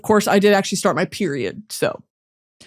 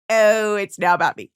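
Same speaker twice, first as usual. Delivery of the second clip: usual speaking voice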